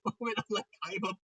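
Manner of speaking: ordinary delivery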